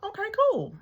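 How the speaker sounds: high, bright okay cool; squeaky casual okay, cool; mock chipper agreement. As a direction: chirp it way up high like a breezy yes. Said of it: "I'm like".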